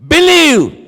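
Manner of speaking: high, loud preacher's cry; shouted exhortation. Real, not acted. Preacher urging congregation to believe, shouted exhortation. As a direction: cry it out to the whole hall.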